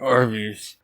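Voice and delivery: muffled behind a hand; hand over mouth. Shown as a demonstration. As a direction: say it through your fingers. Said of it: "put your hand over your mouth and be like".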